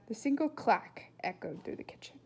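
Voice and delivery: ordinary delivery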